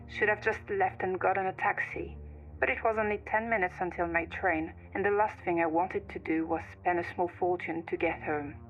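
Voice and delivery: ordinary delivery, nothing marked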